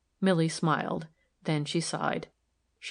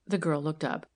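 ordinary delivery, nothing marked

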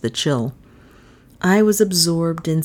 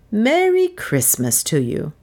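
vicar; 5 semitones higher than usual. Acted. Vicar greeting.

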